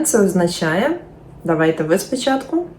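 ordinary delivery

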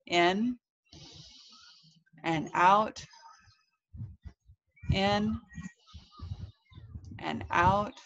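long pauses between each breath cue; slow breathing count. A narrator's reading. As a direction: pace it like guided breathing, waiting after each word. Acting out slow breathing exercise.

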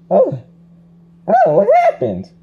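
loud, high bewildered cry; startled awakening. Acted. Luigi waking up after fainting, startled and loud.

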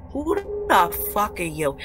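slow, emphatic indignant demand; angry challenge. Real, not acted. Streamer confronting absent ex who wished her dead, indignant. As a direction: stretch the outrage across each word.

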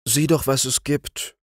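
put-on high child voice, gently asking; pleading little girl. A narrator's reading. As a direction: ask it as a curious sick child. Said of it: "bat Flörchen ihre Cousine".

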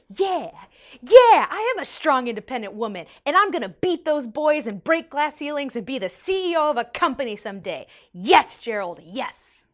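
emphatic, punched-out mock pep-talk cheer; fired-up agreement. Inspired rider cheering along with Gerald's pep talk, fired up. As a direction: act out a pumped-up yes.

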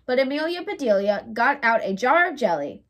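ordinary delivery

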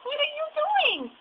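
put-on squeaky-high playful baby voice; high baby talk. Parent playing with baby.

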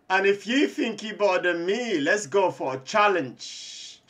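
loud challenge. Real, not acted. Gamer daring a rival to a one-on-one, loud. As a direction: throw down the challenge.

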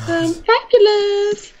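very high, slow fabulous; camp fabulous. Pretend. Camp exclamation.

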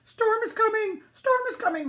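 high, loud dog's alarm; excited sheepdog calling out; barking dog. A narrator's reading. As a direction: yelp out an urgent warning. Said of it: "barked the dog".

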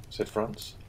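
ordinary delivery

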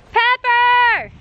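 loud, high call across the field; loud high-pitched call to a missing animal. Real, not acted. Calling animals across the farm.

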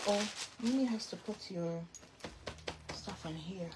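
usual speaking voice

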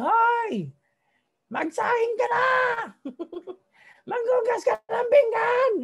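hammed-up shrill boss barking chores at a maid; boss yelling orders. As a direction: screech orders like a tyrant boss. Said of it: "laging sumisigaw".

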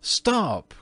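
sharp high-pitched outburst; indignant cry. A narrator's reading. Virginia halting the ghost's insults, indignant. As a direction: cut in like a foot stamp. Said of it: "cried Virginia, stamping her foot".

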